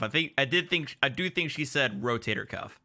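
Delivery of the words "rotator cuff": "rotator cuff" said in full, ro-TAY-tor cuff; spelled-out "rotator cuff". Shown as a demonstration. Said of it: "I don't think she said rotor cuff".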